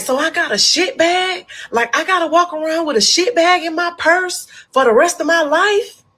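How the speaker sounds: patient flipping out at the nurse, high and loud; freaking out. Retold or imitated. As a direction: shriek it in horrified disbelief. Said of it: "I instantly go to flipping out".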